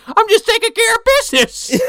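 put-on very high, loud old-coot yell; hammed-up shouted character voice; Grandpa from Terrorvision. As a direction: holler it like a cranky movie grandpa. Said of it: "I'm just thinking of Grandpa from Terrorvision".

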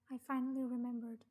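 usual speaking voice

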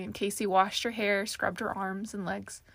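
usual speaking voice

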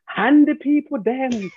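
slow, drawn-out Jamaican speech-making voice; mock Jamaican twang. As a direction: put on an older Jamaican's affected speaky-spokey twang. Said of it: "the older Jamaicans used to twang".

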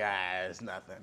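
low, soft, offhand brush-off; lazy mock dismissal; dismissive shrug. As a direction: wave it away like it's nothing. Texans shrugging off tornado siren.

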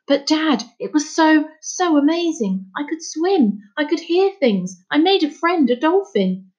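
put-on high, breathless-with-wonder boy voice; excited boy. Boy telling his father about swimming, excited.

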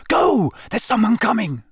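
whisper yelling. A narrator's reading. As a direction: push him away, hissing. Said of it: "he hissed at Sirius".

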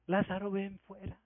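hushed, far too gentle a summons; understated murmur of a call; soft, timid call. Shown as a demonstration. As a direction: say it quietly, with no command in it. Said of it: "seguramente el Señor no le dijo".

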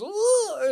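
high, loud dog whine; imitated puppy yelp. Little dog having ears pulled by baby, imitated yelp. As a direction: squeal like a puppy getting its ears tugged. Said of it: "so that the little dog goes".

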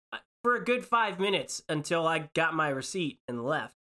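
ordinary talk

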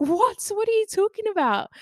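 very high, loud imitated incredulity; mock a baffled partner, pitch shooting up; amused disbelief. Partner baffled by her fraud worry, imitated incredulous. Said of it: "he started laughing".

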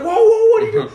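high, loud startled cry; mock shocked. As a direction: gasp it like people caught off guard. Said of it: "everyone's like".

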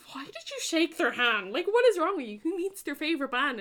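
a friend's imitated disbelief; incredulous friend. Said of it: "Chloe was like".

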